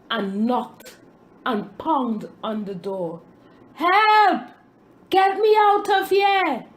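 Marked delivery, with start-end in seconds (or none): 3.7-6.7 high, loud shout for help; yelling for help. A narrator's reading. Bread in the oven shouting for help, high and loud. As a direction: bang on the door and shout. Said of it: "I yelled".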